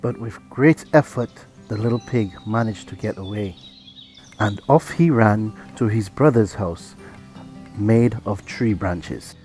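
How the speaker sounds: ordinary delivery, nothing marked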